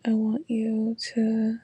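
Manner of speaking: ordinary talk, nothing marked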